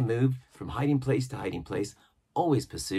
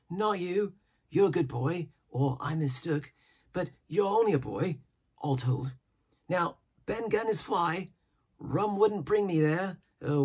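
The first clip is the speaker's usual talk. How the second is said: higher-pitched, excitable marooned sailor; an odd, jittery hermit rattling on; eager old castaway; 6 semitones higher than usual. A narrator's reading. Ben Gunn voiced in reading.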